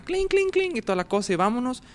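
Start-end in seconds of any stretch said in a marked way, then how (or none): 0.0-0.8 high clinking bell sound, mimicked; bell imitation. Imitating the chapel bell ringing, high and light. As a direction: ring it out like a small church bell. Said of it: "la campana".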